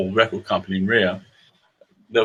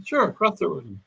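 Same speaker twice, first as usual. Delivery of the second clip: higher-pitched, lightly imitated Irish accent; breezy Irish reassurance, pitched up; helpful Irishman, lightly voiced; 7 semitones higher than usual. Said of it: "the little Irishman said".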